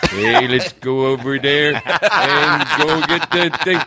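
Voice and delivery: slow, hammed-up drunken Canadian impression. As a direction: stretch it out like a boozy bad John Wayne. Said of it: "my impersonation of a drunk Canadian".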